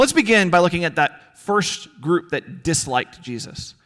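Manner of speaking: usual speaking voice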